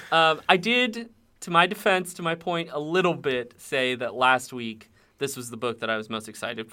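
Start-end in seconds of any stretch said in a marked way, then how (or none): none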